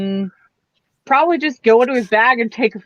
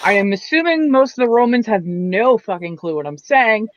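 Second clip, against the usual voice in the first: usual speaking voice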